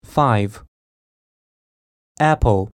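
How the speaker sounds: usual speaking voice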